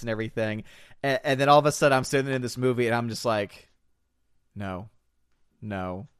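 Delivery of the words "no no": low, soft refusal with pauses; hushed, deadpan disbelief; quiet dismay. Retold or imitated. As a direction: say each word low and separately. Acting quiet dismay during the movie.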